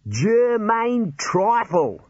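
high, very drawn-out reveal; huge proud grin. A narrator's reading. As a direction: announce the name grandly through a grin. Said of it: "A wider grin would have resulted".

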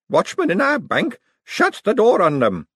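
put-on old lady voice. A narrator's reading. Aunt outraged at watchmen.

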